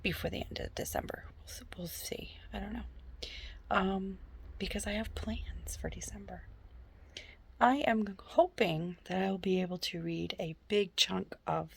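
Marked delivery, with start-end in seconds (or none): 0.0-6.4 soft unvoiced whisper. Real, not acted. Whispering her reading plans to the camera. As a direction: keep it to a hush. Quiet talk while alone at home.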